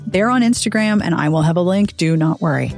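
ordinary talk

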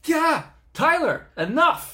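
high loud shout at a prank; startled yell. Real, not acted. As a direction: cry out to make him stop.

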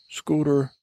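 a hint of a hurt sidekick; sidekick's mild protest. Sidekick [name] protesting to the host, mildly hurt.

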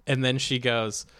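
normal talking voice

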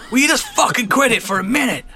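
angry shout. Acted. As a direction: snap at the crewman. Captain shouting at crewman.

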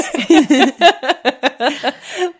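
high, loud burst of giggles; real laughing fit. Real, not acted. Host breaks down reading the messages, real laughing fit. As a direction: crack up loudly and keep going.

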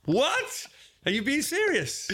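high-pitched, astonished disbelief; mind blown. Retold or imitated. Comedian reenacting hearing about the rock star's skipper, mind blown. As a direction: shoot the pitch right up in acted amazement. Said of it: "mind blown".

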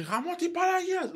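high-pitched mock curse; mimicking father's swearing; mock Greek swearing. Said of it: "in Greek, he would say".

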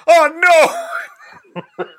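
high, loud, sobbing wail; pitiful wail. Pretend. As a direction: wail it like a crushed man. Acting a pitiful pitch.

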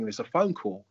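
ordinary talk, nothing marked

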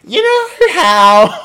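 very high, loud, drawn-out comic impression; Reese Nicholson. Mocking how a comedian would talk dirty, high loud impression. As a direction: screech it slowly in a put-on voice. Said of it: "someone like a Reese Nicholson".

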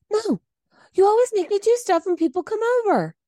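mocking whiny husband. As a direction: mimic him as a petulant child.

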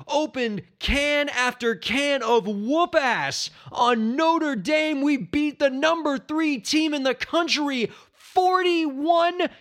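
high, elated shouts of victory; jubilant gloating. Real, not acted. Sports fan celebrates his alma mater's upset win, jubilant.

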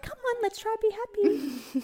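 high, slow, sing-song pep talk; mock cheerful coaxing. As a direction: imitate an annoyingly upbeat encourager. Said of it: "sit there and be like".

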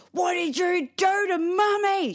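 put-on frightened child shout. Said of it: "Summer was like".

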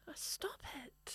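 exaggerated stage whisper. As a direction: hush it right down. Whispering after mic scolding.

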